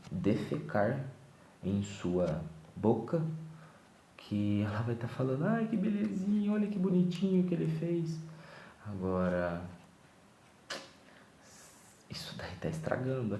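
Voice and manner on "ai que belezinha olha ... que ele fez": put-on high cooing baby talk; cooing mother. Mother cooing at her baby, high baby talk. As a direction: coo over the baby like a doting mom.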